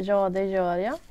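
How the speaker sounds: "ja, det gör jag" in native Swedish pronunciation; Swedish reply. Shown as a demonstration. Teacher demonstrating the Swedish answer, in native Swedish. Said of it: "And in Swedish we would answer".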